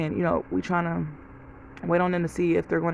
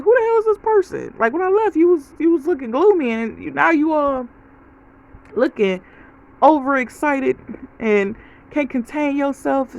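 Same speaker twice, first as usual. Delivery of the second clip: high-pitched, louder retold astonishment; amazed disbelief; 7 semitones higher, 4 dB louder than usual. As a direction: react as if you barely recognize your own mom. Amazed at her mother's sudden change.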